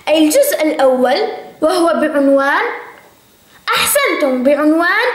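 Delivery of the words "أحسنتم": usual speaking voice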